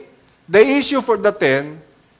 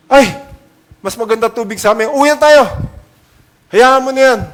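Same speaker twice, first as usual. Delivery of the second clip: petulant refusal; 4 semitones higher, 7 dB louder, faster than usual. Pretend. Imagining Naaman refusing, petulant.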